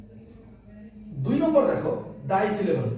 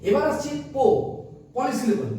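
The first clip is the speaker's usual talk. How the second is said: ordinary talk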